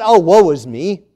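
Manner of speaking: loud, hammed-up "Oh, woe is me"; preacher mocking a self-pitying believer; mock whine. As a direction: moan it like self-pity.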